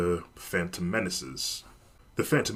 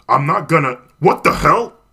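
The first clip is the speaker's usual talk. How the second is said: startled shout; 7 semitones higher, 11 dB louder than usual. Acted. Man forced against his will.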